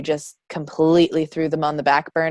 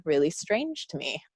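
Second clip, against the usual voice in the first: ordinary delivery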